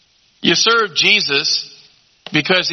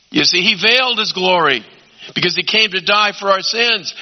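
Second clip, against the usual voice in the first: usual speaking voice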